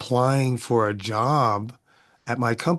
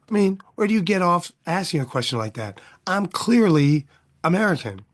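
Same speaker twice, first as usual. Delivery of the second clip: higher-pitched, mildly indignant challenge; indignant retort; 7 semitones higher than usual. Acted. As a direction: take offense, pitch lifted. Acting out the fed-up scholar's retort.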